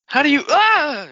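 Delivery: hammed-up yelp of pain. Friend replays the stabbed man, hammed-up pained yell. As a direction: overplay being stabbed mid-sentence.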